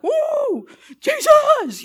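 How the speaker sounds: put-on high, loud teenage cheer; excited whoop. Teenagers back from youth camp, whooping loud. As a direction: whoop it up like a fired-up teen. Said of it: "they're like".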